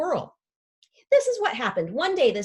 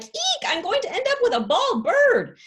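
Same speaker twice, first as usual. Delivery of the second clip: shrieking high mock-panicked Rosa; imitating Rosa; 13 semitones higher, 5 dB louder than usual. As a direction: squeal it in alarm, much higher. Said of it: "She was like".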